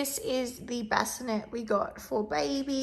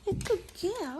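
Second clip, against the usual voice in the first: high pet praise; 11 semitones higher, 5 dB quieter than usual. Real, not acted. Coos praise high to her good girl dog.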